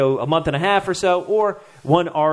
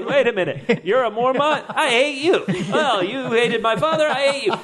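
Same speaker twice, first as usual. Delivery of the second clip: higher, laughing, mock indignant; half-laughing hammed-up grudge; Tormund's outburst; 7 semitones higher than usual. Tormund learning Jorah is a Mormont, high mock indignant. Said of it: "Tormund to say".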